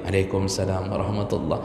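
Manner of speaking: soft reply he makes sure is not heard; small voice. Retold or imitated. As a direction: keep it low so the Prophet can't hear. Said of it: "kecil suaranya". (suara kecil, sahabat menjawab salam pelan-pelan)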